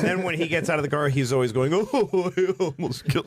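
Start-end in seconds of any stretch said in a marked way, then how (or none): 1.7-3.3 higher-pitched mock wail; fake crying. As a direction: put on phony sobs, badly faked. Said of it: "like, fake crying".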